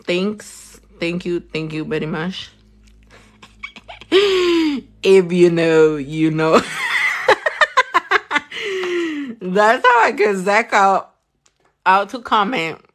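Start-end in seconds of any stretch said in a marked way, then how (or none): 3.6-4.8 high bright chuckle; high laugh. Real, not acted. Teasing viewers. 6.6-9.3 high bright chuckle; high laugh. Real, not acted. Teasing viewers.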